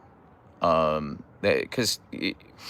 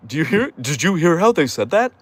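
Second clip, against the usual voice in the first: high, incredulous mocking aside; hammed-up, high-pitched disbelief; mock scandalised gossip; 8 semitones higher, 3 dB louder than usual. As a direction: act shocked at someone's pronunciation. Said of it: "I'm not gonna be like".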